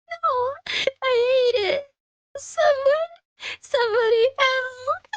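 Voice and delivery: put-on high, tearful girl pleading for help; a frightened girl close to tears; distraught young girl. Sissy's tearful monologue.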